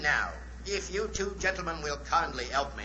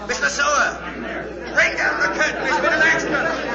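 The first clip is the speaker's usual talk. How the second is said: higher and louder, calling urgently; alarmed shout; 7 semitones higher, 7 dB louder than usual. Acted. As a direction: shout for the curtain in sudden alarm. Magician discovers shot assistant.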